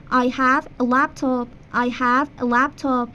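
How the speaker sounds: slow teacher demo of the sentence; slow model sentence. Shown as a demonstration. Teacher modelling English for Persian students, slow. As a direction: say it slowly for repetition. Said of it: "من یه لپتاپ دارم".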